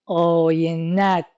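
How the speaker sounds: آینت slow and stretched, ending on a fall, ت joined to آینه with no vowel; آینت drawn out with no vowel before the ت; metrical reading. Shown as a demonstration. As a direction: say آینت slowly as one word, to the metre.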